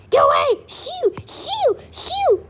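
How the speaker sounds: high, squeaky mock panic; panicked shooing. Reader chasing off the odd little boy, mock-panicked squeal. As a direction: squeal each shoo very high.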